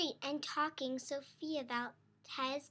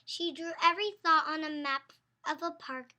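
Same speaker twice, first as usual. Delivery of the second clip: ordinary delivery, nothing marked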